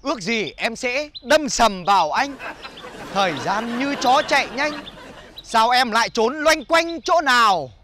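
slow, drawn-out comic verse recital; poem recitation. Acted. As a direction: declaim a silly love rhyme.

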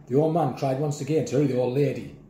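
ordinary delivery, nothing marked

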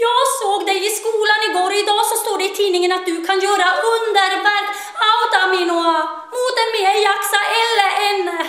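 high, loud, fast tearful pleading; a crying teen girl bursting out at the door; sobbing girl. Acted. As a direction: blurt it out through tears. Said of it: "storgråtande".

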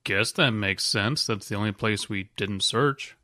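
ordinary talk

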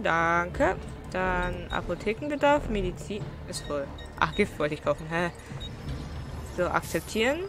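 normal talking voice

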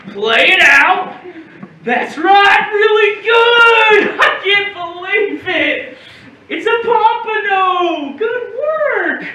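high, drawn-out whoops of praise; elated cheering. Acted. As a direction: cheer the boy on as the fish lands. Uncle cheers as fish is landed.

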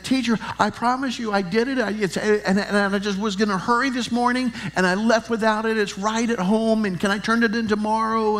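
put-on high, rushed, pleading little boy; panicked pleading kid. Ten-year-old begging his teacher, high and panicky. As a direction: beg the teacher like a scared fifth grader. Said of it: "And I was panicked".

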